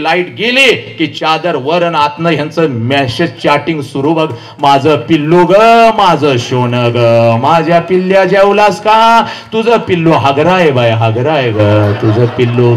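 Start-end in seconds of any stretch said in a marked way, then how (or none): 4.6-9.2 put-on drawn-out, cooing lovers' baby talk; hammed-up lovey-dovey chat; mock sweet-talk. Mimics teenagers' sweet-talk chatting. 9.5-12.8 teasing parody of sweet-talk; mocking sing-song. Mocking answer to lovey-dovey chat.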